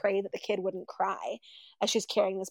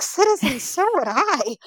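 playing along; 6 semitones higher, 6 dB louder than usual. Priest plays along with soldier.

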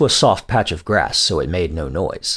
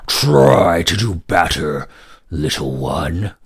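slow, lower, mocking dragon; 5 semitones lower, slower than usual. Dragon taunting the young boy, slow and lower. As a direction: talk down to the small boy like a huge beast. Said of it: "the dragon said".

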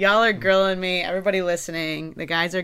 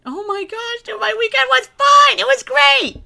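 flustered squeak; 8 dB louder than usual. Real, not acted. Flustered answering about weekend.

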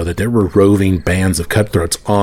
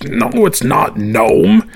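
very high, slow, strange second-head voice; a silly high creature voice for the second head; high squeaky monster head; 14 semitones higher, much slower than usual. Acted. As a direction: squeak it out slowly like a dim little monster head. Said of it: "I like that voice".

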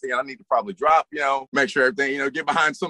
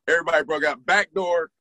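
usual speaking voice

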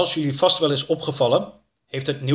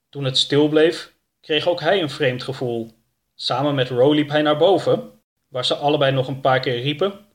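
usual speaking voice

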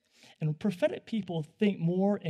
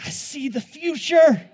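mystical visionary; 9 semitones higher, 11 dB louder, slower than usual. Retold or imitated. Mimics himself as grand visionary.

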